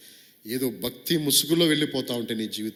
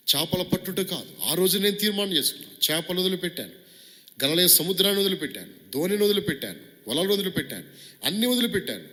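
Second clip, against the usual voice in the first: impassioned Peter; 8 semitones higher, 4 dB louder than usual. Acted. Peter speaking in first person.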